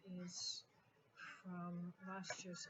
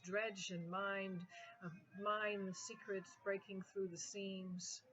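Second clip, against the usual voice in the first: driving, rhythmic poetry recitation; spoken-word poem; 6 dB louder than usual. Acted. Poet recalling her freer younger self to an audience, emphatic spoken-word recitation. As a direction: build into an emphatic cascade of rhythmic phrases.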